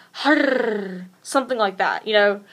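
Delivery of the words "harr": a rolled, purring "Harr" with the tongue trilling. Shown as a demonstration. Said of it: "roll your tongue like".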